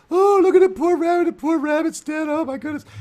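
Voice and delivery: very high, loud lament over a dead animal; mock-pitying high hippie voice; distressed hippie. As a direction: wail it in a squeaky high falsetto. Hippies mourning a rabbit in joke.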